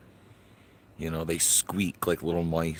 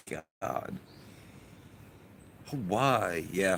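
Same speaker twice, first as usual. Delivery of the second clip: ordinary talk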